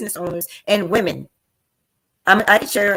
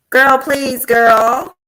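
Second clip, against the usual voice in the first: loud dismissive; 3 semitones higher, 9 dB louder, much slower than usual. Real, not acted. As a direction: brush it off, full sass. Repeated dismissal, loud and emphatic.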